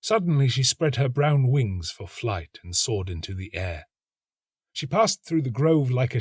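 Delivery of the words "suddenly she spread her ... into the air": ordinary delivery, nothing marked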